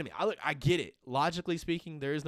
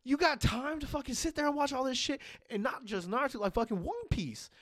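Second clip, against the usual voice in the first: higher, louder worked-up rant; higher, louder incredulous rant; 12 semitones higher, 3 dB louder than usual. Real, not acted. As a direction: rant like it's absurd. Ranting about long anime.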